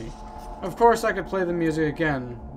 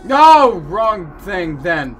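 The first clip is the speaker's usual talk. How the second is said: slow, much louder self-correction; 2 semitones higher, 11 dB louder, much slower than usual. Real, not acted. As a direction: bark it out slowly. Loud correction of wrong answer.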